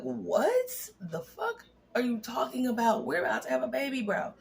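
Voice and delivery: high, disbelieving outburst; shocked outrage. Retold or imitated. Pregnant woman to fiance on phone, high shocked disbelief. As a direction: hit it high in total disbelief.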